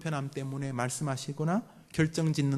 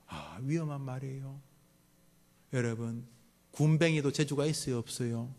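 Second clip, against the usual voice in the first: ordinary delivery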